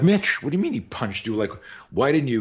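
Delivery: high-pitched, acted disbelief; incredulous questioning. Coach to student who got punched, incredulous. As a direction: question him as if you can't believe it.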